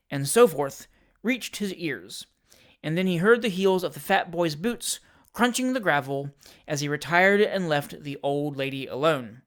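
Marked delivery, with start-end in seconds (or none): none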